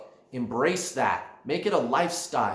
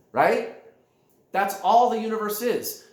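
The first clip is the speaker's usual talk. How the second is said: ordinary talk, nothing marked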